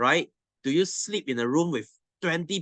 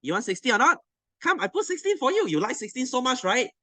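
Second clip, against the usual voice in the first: high-pitched mock offer to the shivering class; teasing; 8 semitones higher than usual. Teacher teasing cold students, high and playful. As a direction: taunt them with a raised, playful voice. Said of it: "I was teasing them and say".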